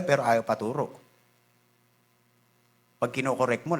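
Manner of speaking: normal talking voice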